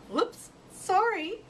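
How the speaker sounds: high, slow bashful apology; a shy owl caught out, apologising; embarrassed. A narrator's reading. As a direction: blush as you say it. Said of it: "she blushed".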